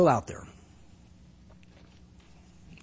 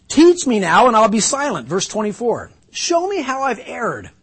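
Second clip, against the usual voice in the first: raised, higher voice begging to be taught; pleading Job; 7 semitones higher, 8 dB louder than usual. Acted. Job begging his friends to teach him, pleading. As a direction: plead as wounded Job asking to learn.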